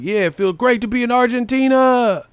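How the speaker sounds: high, bright mock announcer line; mocking Tim Bradley. Streamer imitating the commentator's Australia blunder in a high voice. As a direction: play a clueless commentator with cheerful confidence. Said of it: "He's like".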